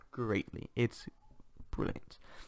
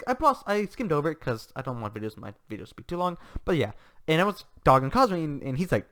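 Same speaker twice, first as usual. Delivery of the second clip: ordinary delivery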